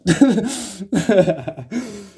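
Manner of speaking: loud, high burst of laughter; real high-pitched laugh. Real, not acted. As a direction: laugh at how silly it was. Laughing at the dog attack.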